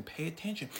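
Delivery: ordinary delivery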